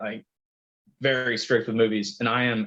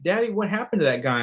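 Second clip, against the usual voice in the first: put-on higher, small child's question; little kid voice; 6 semitones higher, faster than usual. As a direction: lightly voice a small child asking. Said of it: "I hear a little voice behind me".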